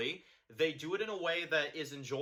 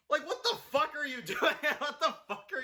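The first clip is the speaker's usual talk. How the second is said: high, louder incredulous laugh-through; laughing disbelief; 11 semitones higher, 4 dB louder than usual. Real, not acted. Disbelief at a bad scene.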